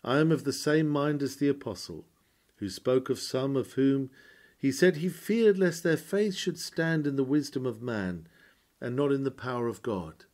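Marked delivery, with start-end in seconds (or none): none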